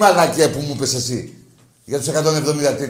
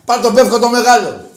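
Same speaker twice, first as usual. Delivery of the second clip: high, very loud jeer; shouted retort; 9 semitones higher, 10 dB louder than usual. Real, not acted. Host mocking the caller's place name, shouted retort. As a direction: holler it after the caller. Crude retort after hanging up.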